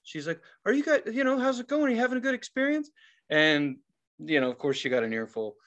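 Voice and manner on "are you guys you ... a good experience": high-pitched polite check-in; security woman. Retold or imitated. Security woman to upset guests, high polite inquiry. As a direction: imitate a customer-service voice.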